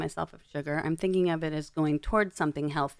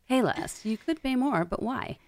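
announcer voice; 6 semitones higher than usual. Shown as a demonstration. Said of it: "So it's the announcer".

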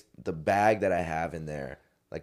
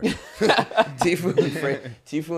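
laughing through the words; 10 semitones higher, 9 dB louder, much slower than usual. Real, not acted. Laughing at his own story.

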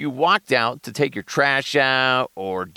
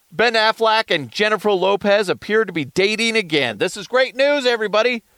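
high-pitched, excited big-news reveal; hyped announcement; 10 semitones higher, 4 dB louder than usual. Pretend. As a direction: announce it like thrilling breaking news.

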